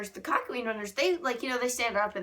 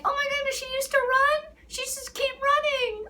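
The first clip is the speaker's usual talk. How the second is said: very high, amazed squeal, slowing at the end; high-pitched mock astonishment; amazed fangirl; 3 dB louder than usual. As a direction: gasp with delight at the news. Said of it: "And I was like".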